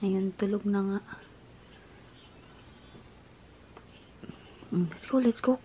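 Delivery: hushed low voice; quiet at night. Real, not acted. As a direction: keep it down, she's sleeping. Sister asleep nearby.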